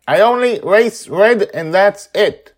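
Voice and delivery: put-on high, loud girl's shout; shouting back. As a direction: yell it back defiantly. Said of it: "She's shouting back".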